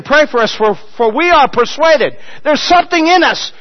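high, loud preaching; rising full-voice sermon climax; full-force preaching. Acted. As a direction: preach it out with fire.

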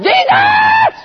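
very high, strangled holler; stretched, piercing crazy-man yell; weird yelling. Acted. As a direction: shriek the name in a strange voice. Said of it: "Strange voice".

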